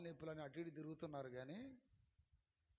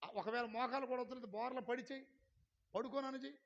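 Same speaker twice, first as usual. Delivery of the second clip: ordinary talk, nothing marked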